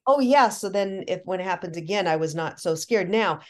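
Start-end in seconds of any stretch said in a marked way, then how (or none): 0.0-0.5 excited realization. Retold or imitated. Realizing she can take control.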